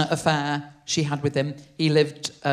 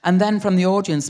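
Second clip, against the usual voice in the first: ordinary talk, nothing marked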